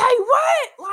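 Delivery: high, loud shocked "What?"; hearing he'll tell the homies first, stunned. Retold or imitated. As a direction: jump up in pitch in disbelief. Shocked at his reply.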